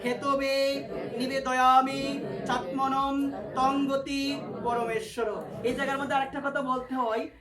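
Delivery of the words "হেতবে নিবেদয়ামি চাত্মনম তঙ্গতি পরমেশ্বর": slow, spaced mantra with pauses; mantra recitation. Shown as a demonstration. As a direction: lead the mantra word by word. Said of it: "সবাই মিলে আমার সঙ্গে বলো".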